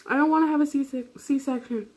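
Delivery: high-pitched wail without real volume; mock crying scream. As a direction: imitate yourself wailing in protest, voice pitched high. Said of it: "I'm crying, screaming".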